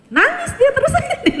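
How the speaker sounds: lightly mock a child's wail; squeaky high laugh-voice. Speaker laughs while telling how the boy kept crying, squeaky high. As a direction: jump way up and squeal it out.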